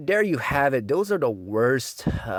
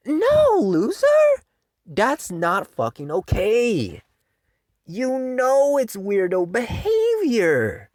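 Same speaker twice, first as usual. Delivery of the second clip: scornful scolding; 12 semitones higher, 5 dB louder, slower than usual. Real, not acted. Scolding fans after mockery.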